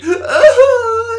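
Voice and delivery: very high, loud imitated whimpering cry; mock little cry. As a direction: squeak out a pathetic little sob. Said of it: "that little cry he goes at the end".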